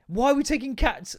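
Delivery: ordinary delivery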